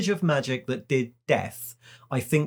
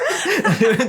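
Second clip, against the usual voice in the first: high laugh; 11 semitones higher, 5 dB louder than usual. Real, not acted. Laugh after imitation.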